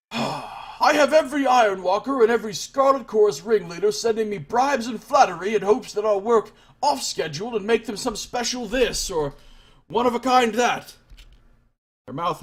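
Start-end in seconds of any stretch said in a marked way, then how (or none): 0.1-10.9 higher, forceful craftswoman griping; fed-up master smith rattling off grievances; exasperated forge master. Acted. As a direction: complain in character, emphatically. Forge master complains about requests.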